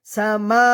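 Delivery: "সামা" with the last vowel held; one-alif elongation. Shown as a demonstration. Teacher showing learners "সামা" with a held final vowel. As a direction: draw out "মা" in "সামা" one beat. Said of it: "এক আলিফ টান হবে".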